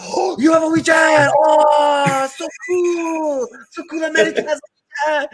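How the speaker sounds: very high, squealing mock amazement; amazed. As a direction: imitate starstruck contacts, voice shooting way up. Acting amazed contacts' reaction.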